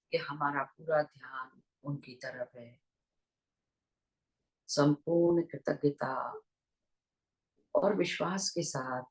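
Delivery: unhurried meditation guidance with long pauses; guided meditation. Real, not acted. Teacher leading online listeners into breath awareness, guided meditation voice. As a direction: guide listeners gently into their breath, leaving long silences.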